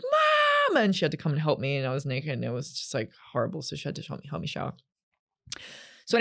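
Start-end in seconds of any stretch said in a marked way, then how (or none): 0.0-0.8 high, piercing call for mom. Retold or imitated. Calling mom from the shower, a very high piercing cry.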